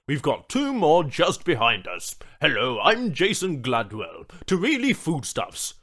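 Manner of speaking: loud, brisk corporate man; breezy businessman apologizing. Acted. Businessman introducing himself.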